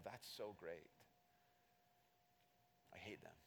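muttered envy. Retold or imitated. Sarcastic envious aside.

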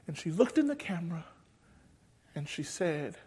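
normal talking voice

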